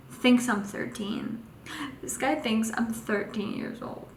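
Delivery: hushed, mock-offended muttering; dismayed aside. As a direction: drop to a soft, stunned aside. Retold dismayed aside about his guess.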